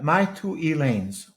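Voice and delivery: My Two Elaines said slowly, each word clear; spelled out slowly. Shown as a demonstration. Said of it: "all one word".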